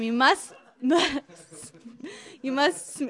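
insisting while breaking into laughter; laughing plea. Retold or imitated. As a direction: keep pleading but laugh through the words. Pleading with gossip lady, cracks up.

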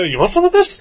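loud, high-pitched taunt; mock challenge. Storyteller re-enacting a standoff with a goose, mock-threatening.

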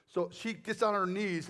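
usual speaking voice

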